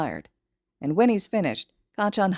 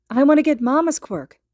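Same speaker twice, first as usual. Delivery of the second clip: put-on high, louder cheerful child chirp; bright chirp; 8 semitones higher, 7 dB louder than usual. A narrator's reading. Little boy chirps happily to his mother. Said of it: "grins brightly at his mother and chirps".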